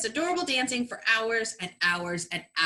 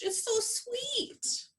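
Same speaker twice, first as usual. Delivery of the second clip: much higher, softer, slow coo; 11 semitones higher, 8 dB quieter, much slower than usual. Acted. Recapper mocking the cute couch scene, high slow coo. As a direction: gush over something adorable. Cooing over sleeping pair.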